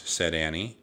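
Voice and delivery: plain reading voice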